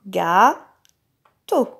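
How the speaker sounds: "Gâteau" as two separate syllables, long pause between. Shown as a demonstration. Teacher taps "Gâteau" together with the child, syllable by syllable. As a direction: draw out "gâ" with a rise, pause, then a short "teau". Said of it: "C'est la dernière syllabe du mot".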